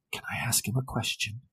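unvoiced whisper leaning in close; leaning in close to ask about the man, whispered. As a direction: breathe it into her ear. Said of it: "leans in close and says".

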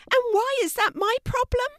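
very high, squeaky fish voice; indignant fish. Acted. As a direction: challenge her as a high-pitched little fish. Indignant fish answers Kelty.

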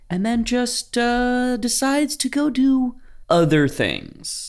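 lightly mocking the government, high and slow. Mocking government ditching promises.

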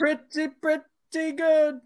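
high, drawn-out Larry David pretty good; Larry David impression. Doing Larry David for the guys, high and slow. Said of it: "It's my Larry David".

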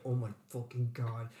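low, hushed exasperated swearing; retold exasperation. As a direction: swear softly to yourself, replaying the frustration. Acted exasperation during the raft fight.

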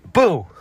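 loud high boo; startling boo. Acted. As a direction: jump out and shout boo. Prankster jumps out.

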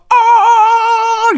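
very high, loud stretched "ah"; high rock-star wail. Retold or imitated. The rock star wailing back at the duo. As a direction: let out a piercing held cry. Voice contest shout.

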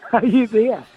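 retold insistent call. Grandson on phone to silent grandmother, retold insistent call.

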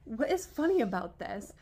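retold affronted challenge, punched out; offended. Confronting her laughing friends in a retold scene, offended. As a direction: demand an explanation, indignantly. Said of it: "offended that they're laughing".

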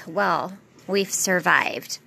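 ordinary talk

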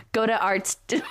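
broad Aussie twang, laughing; putting on an Aussie accent; Australian accent. Pretend. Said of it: "Just an Australian accent".

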